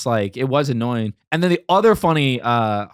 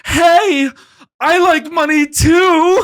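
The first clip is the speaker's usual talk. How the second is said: high, loud, drawn-out holler; shouting homeless man; 8 dB louder, much slower than usual. Retold or imitated. Second man across the train wants money too, hammed-up high holler. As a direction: call out from far off, high and stretched. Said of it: "I just hear him go".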